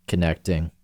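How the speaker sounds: normal talking voice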